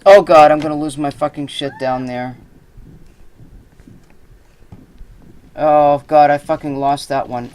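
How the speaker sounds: real frantic outburst; panicked yelling. Real, not acted. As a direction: shout as things slip away. Items falling out of reach.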